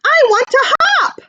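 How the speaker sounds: teacher voicing baby kangaroo Joey for the class, high and eager; eager little joey. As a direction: squeak it out like an excited little joey. Joey voiced in story.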